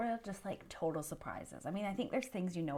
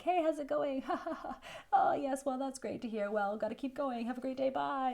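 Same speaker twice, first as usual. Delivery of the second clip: breezy small talk; 7 semitones higher, 4 dB louder than usual. Retold or imitated.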